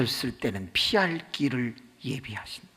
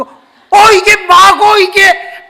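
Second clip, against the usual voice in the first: much higher, louder, slow furious roar; furious husband; 15 dB louder, much slower than usual. Retold or imitated. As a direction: roar at her like an enraged husband. Husband attacks wife.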